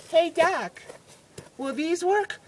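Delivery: high put-on nurse voice; mock perky assistant; eager nurse. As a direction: call to the doc in falsetto. Nurse shows tools to doctor.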